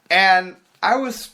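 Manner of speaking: ordinary delivery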